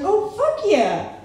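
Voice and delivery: high, casual mom bragging about her wild past; mom's voice. Retold or imitated. Comic retelling the mother's answer in a high put-on voice. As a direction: toss it off like an old party girl. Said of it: "And she's like".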